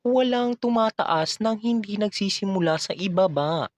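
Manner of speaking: high-pitched broadcaster impression; Corina Sanchez. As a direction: quote her in a put-on TV voice. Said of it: "Sabi nga ni Corina Sanchez". (ginagayang matinis na boses ng brodkaster)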